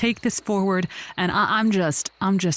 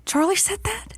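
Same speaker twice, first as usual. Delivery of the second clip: higher, disbelieving gasp of a question; can't believe what he just heard; shocked; 11 semitones higher, 3 dB quieter than usual. Acted. Friend hearing about Charlie, shocked disbelief.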